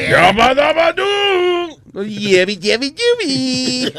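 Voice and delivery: slow, high hammed-up cartoon yell; Pedro Picapiedra voice. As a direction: belt out the caveman catchphrase. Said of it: "él lo decía más hombrecito". (grito de caricatura exagerado y lento)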